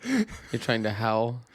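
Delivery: normal talking voice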